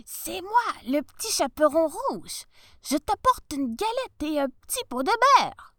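wolf faking a high little voice; tiny voice. Acted. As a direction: squeak it sweetly, pretending to be the girl. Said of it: "dit d'une toute petite voix".

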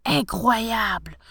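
slow, drawn-out disbelief; marvelling, not shouted; amazed. A narrator's reading. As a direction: stretch the word out in dazed wonder. Professor amazed on landing in garden.

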